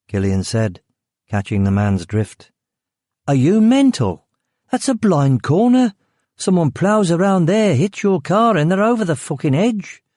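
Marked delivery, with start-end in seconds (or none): 3.3-10.0 exasperated Brummie guard. Acted. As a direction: scold him like he's an idiot. Guard scolds the fool.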